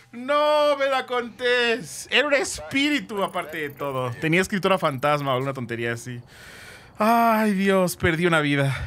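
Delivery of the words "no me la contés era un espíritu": very slow, higher stretched "No me la contés"; drawn-out disbelief. Real, not acted. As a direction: stretch every word in disbelief. Drawn-out disbelief after losing. (incredulidad muy lenta y aguda)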